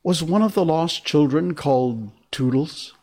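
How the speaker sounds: put-on high, hopeful child's question; eager cry. A narrator's reading. Toodles asking Wendy about the lost children, eager cry. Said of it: "cried Toodles".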